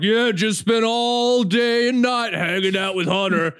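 high, drawn-out boastful mock voice; Donut Operator. Snubbed friend mimics a rival's boastful tweet, mock gloating voice. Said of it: "I saw donut operators like".

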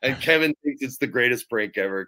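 ordinary delivery

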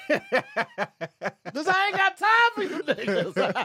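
laughing through it, high and slow; cracking up as you land the joke; laughing punchline. Real, not acted. Host to co-host, laughing through the punchline.

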